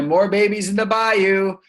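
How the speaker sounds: excited. Acted. Excited discovery after "oh boy.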